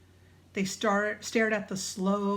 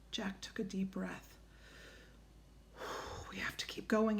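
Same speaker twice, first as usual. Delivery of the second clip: hushed, breathy whisper; barely voiced, tense hush; 11 dB quieter than usual. A narrator's reading. Hushed tense moment in forest.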